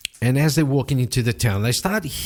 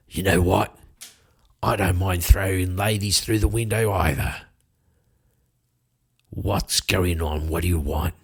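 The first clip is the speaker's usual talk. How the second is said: menacing thug; 4 semitones lower than usual. Acted. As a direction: lean in and threaten them. Thug threatens to throw ladies.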